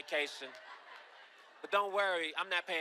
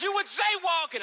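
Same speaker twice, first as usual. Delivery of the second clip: much higher, loud cop bark; stern cop shout; 12 semitones higher, 7 dB louder than usual. Retold or imitated. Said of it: "And he says".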